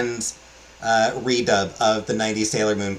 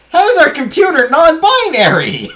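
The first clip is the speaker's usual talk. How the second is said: very high, squealing through laughter; laughing disbelief; 5 dB louder than usual. Real, not acted. Speaker just realizing their computer is non-binary, helpless laughing disbelief. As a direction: crack up loudly at the absurdity.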